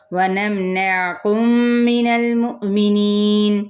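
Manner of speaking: Quranic recitation. Shown as a demonstration. Teacher reciting a verse for students, melodic tajweed style. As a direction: recite the verse as the model to copy. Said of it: "میم پہ اظہار شفوی".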